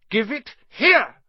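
high, loud, drawn-out yell; loud angry command. A narrator's reading. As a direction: yell a furious demand. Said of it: "Harry yelled".

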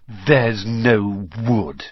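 very slow, drawn-out mock wail; mocking mimicry. Ron mocks Hermione after the danger, mimicking her slowly. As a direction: drag it out to ridicule her silly excuse.

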